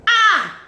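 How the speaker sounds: high, loud "ah" of amazement; sudden piercing yelp; sharp exclamation. Real, not acted. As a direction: cry out in admiration. Exclaiming over the actress's performance.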